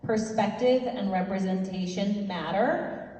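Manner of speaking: ordinary talk, nothing marked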